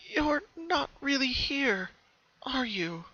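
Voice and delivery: high, slow hesitant question; slow high wondering voice; unsure Pinky. A narrator's reading. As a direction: ask it half afraid. Pinky doubts the ghost.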